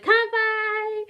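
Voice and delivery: high, loud cheer of Kanpai; toasting milk cartons with the class; cheerful toast. Retold or imitated. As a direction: shout it like a happy toast.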